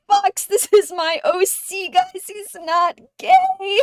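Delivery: high, loud, whining mock sob; mock crying. Fox crying to the guys about their character, a mock wail. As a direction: wail it like a hammed-up tantrum. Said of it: "Fox just starts crying".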